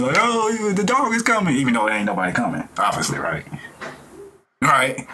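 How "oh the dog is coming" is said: high, hammed-up fake alarm; mock panic. Joking about running from dogs, a high mock panicked cry. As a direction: act scared for a laugh. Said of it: "just off the goofiness, just being funny".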